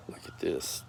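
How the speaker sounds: hushed whisper of surprise. Real, not acted. Vlogger whispers to her friend about her own hair. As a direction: whisper it as if caught off guard.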